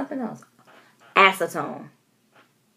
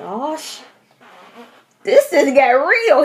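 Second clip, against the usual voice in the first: alarmed; 8 semitones higher, 8 dB louder than usual. Retold or imitated. Fresh bug appears, alarmed.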